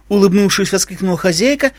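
plain reading voice

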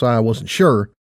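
plain reading voice

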